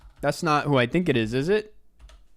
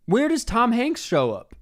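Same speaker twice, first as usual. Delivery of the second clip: higher, laughing disbelief; really can't believe Tom Hanks is in it; incredulous; 8 semitones higher than usual. Real, not acted. Streamer asking chat in laughing disbelief about the cast.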